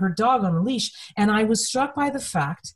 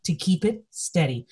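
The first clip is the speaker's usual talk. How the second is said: usual speaking voice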